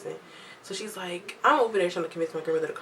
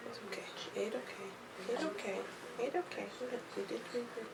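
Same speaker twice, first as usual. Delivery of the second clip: very soft, whispery coo; 5 semitones higher, 12 dB quieter than usual. Real, not acted. Mom comforts her fussing baby, hushed soothing baby talk.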